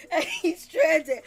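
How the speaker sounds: high squealing laugh, then slow words squeezed out through it; laughing so hard the words barely come out; helpless laughter. Real, not acted. Laughing at stranded Boogie.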